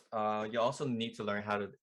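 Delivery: ordinary talk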